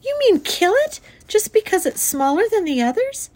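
high, loud, appalled question; shrieking protest. A narrator's reading. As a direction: protest shrilly like a horrified child. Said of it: "shrieked Fern".

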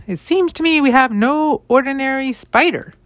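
lightly acted skeptical wife setting him straight; pointed correction. Wife counters husband's miracle claim.

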